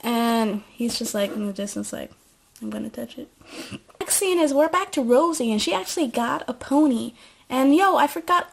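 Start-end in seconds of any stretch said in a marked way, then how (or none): 2.6-3.3 much softer, as if far away; soft distant mutter. Retold or imitated. Stranger answering Zoe from the distance, much softer. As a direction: mutter it under your breath from afar.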